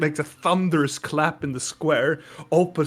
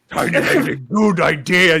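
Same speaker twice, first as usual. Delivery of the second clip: Minotaur voice; 5 dB louder than usual. Acted. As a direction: boom approval.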